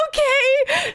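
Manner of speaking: very high, uneasy agreement; reluctant. Retold or imitated. Caller giving the man water, reluctant. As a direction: squeak out a mock-willing okay.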